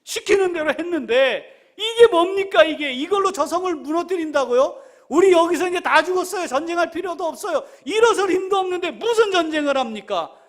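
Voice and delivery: high, loud, whining tirade; hammed-up despairing outburst; exasperated protest to God. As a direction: complain bitterly as an exhausted soldier. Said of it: "뭐 이런 마음인 거죠".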